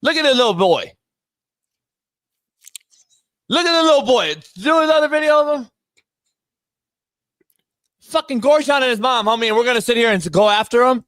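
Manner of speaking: high-pitched, incredulous rant; outraged disbelief. Real, not acted. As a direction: protest in disbelief, pitch pushed way up. Outraged reaction to video of child.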